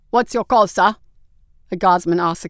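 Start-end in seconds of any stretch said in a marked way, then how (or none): 0.1-1.0 raised query. Acted. A guardsman asks the captain for orders, raised query. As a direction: put it briskly to a superior.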